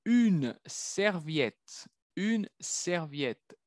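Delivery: Une serviette drawn out very slowly; slow model word. Shown as a demonstration. Teacher slowly models a new word. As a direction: stretch each sound of Une serviette. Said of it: "slow model word".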